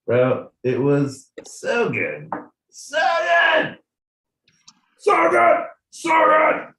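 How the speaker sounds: high, loud ecstatic shout; excited shouting. Real, not acted. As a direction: gush it out loud. Gushing about fishing trip.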